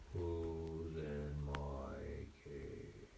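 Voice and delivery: very slow, low, hushed monster voice; menacing cave creature. Acted. Unseen creature to kitties hiding in its cave, slow low menace. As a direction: draw every word out low and ominous.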